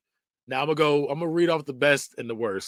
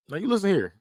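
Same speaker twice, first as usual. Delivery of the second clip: put-on higher scolding old-timer; mock a scolding grandpa, pitched up; stern old-timer; 7 semitones higher, faster than usual. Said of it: "I can hear someone saying that".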